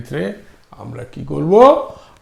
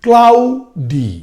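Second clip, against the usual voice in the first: Cloudy very slowly, high stretched klau, separate falling di; split into syllables; 6 semitones higher, 4 dB louder, much slower than usual. Shown as a demonstration. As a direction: pull cloudy apart into two beats. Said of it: "আর দুটো যদি হয়".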